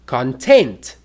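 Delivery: "content" stressed on the last syllable; con-TENT, weight on the end of "content"; stress on second syllable. Shown as a demonstration. Teacher contrasting two words, "content" stressed at the end. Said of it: "closer look at stress".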